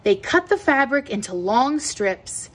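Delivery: ordinary delivery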